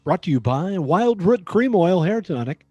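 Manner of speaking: ordinary talk, nothing marked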